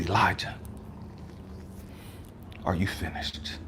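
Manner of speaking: quiet voice of God. Retold or imitated.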